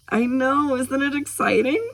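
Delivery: put-on high, excited reply to a child; high-pitched, delighted agreement. A narrator's reading. Ari shares the girl's excitement.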